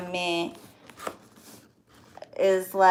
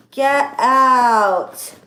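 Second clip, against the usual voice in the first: loud, delighted get out; can't believe the gift, exclaiming; thrilled disbelief; 3 semitones higher, 8 dB louder than usual. Real, not acted. Opening a Gucci bag from a friend, loud delighted disbelief.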